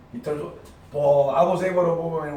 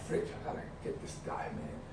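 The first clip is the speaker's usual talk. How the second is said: hushed, gritted-teeth self-talk; muttered frustrated inner voice; whispered frustration; 15 dB quieter than usual. Retold or imitated. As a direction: whisper it like a thought. Inner thought while losing.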